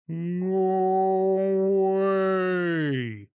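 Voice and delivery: high, long-drawn whale call; slow, swooping whale voice; bellowing whale. A narrator's reading. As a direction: stretch each word into a whale bellow. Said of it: "bellows whale".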